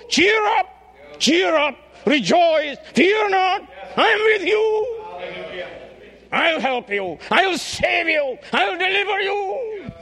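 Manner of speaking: high, loud shouted promise; booming encouragement. Acted. God speaking to the church, a high loud shouted promise. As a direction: shout hope across the room. Preacher voicing God's encouragement.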